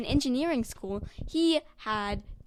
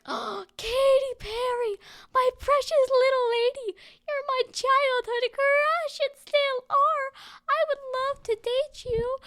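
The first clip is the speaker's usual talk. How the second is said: lovestruck falsetto; 13 semitones higher, 4 dB louder than usual. Acted. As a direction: swoon over her in falsetto. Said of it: "Mark was like".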